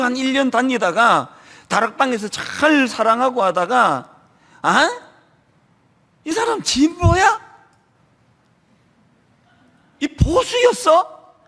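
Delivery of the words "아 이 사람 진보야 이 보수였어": rising, half-shouted disbelief, slowing at the end; mock shocked discovery. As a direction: ham up finding out the neighbor's politics. Mocking members discovering neighbors' politics. (깜짝 놀란 척 높아지는 목소리)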